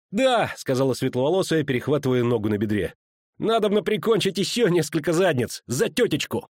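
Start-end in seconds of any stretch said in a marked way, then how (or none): 0.1-0.5 eager yes. A narrator's reading. Fighting girl agrees eagerly. 3.4-6.5 eager fighting talk. A narrator's reading.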